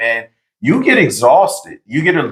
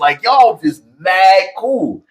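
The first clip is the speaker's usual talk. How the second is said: gushing strangers; 8 semitones higher, slower than usual. Retold or imitated.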